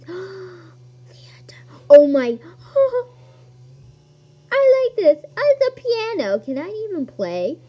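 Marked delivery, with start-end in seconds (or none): 0.0-2.4 gasp, then breathless whispered awe; awestruck gasp. Real, not acted. Player finding the theater, awestruck whisper. 4.5-5.7 high, loud burst of glee; excited delight. Real, not acted. Player discovering a piano, loud high delight.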